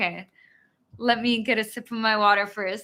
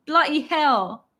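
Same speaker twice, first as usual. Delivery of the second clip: more confident mock British; British imitation of an exclamation; attempted British accent; 3 semitones higher than usual. Shown as a demonstration. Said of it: "Oh, that's better. I can do that one better".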